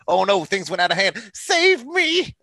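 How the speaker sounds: high, loud whiny cry for help; mock whiny plea. Podcaster mocking Tetsuo begging for rescue, high whine. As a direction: wail like a scared brat. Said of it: "Tetsuo also did that".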